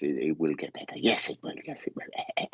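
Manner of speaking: lightly voiced lady, fading into voiceless sound; imitated lady. Medium relaying a lady's words to the listener, lightly imitated. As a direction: echo the lady's insistence, losing voice toward the end. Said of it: "whoever that lady is".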